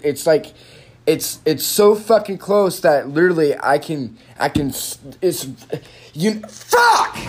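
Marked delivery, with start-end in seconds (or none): 6.1-7.2 high, loud cry of frustration; frustrated shout. Real, not acted. Complaining he's so close to fixing it, real frustrated shout. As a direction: explode as the goal slips away. Frustration peaks mid-sentence.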